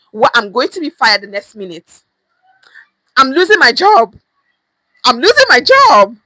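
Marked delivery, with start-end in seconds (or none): 3.2-6.2 panicked. Pretend. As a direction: cry out that the job is lost. Boss firing, dramatized panic.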